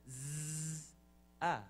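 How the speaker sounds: long buzzed Z, then short "ah"; bumblebee buzz. Shown as a demonstration. Teacher reciting what a bumblebee says, buzz into "ah". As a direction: hold the buzz, release on "ah". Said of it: "A bumblebee says".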